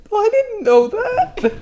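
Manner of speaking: high hammed-up innocence; mock innocent. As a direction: fake a teary innocent voice. Mock innocent denial, crying.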